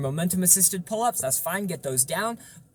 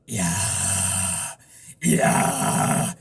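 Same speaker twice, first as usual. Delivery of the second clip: distorted yeah; 7 semitones lower, 4 dB louder than usual. Shown as a demonstration. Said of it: "you could imitate and fake".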